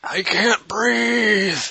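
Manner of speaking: high, slow choking cry; strangled gasp. Pretend. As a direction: squeeze out a mock gasp. Crushed by bear hug.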